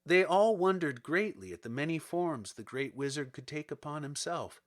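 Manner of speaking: usual speaking voice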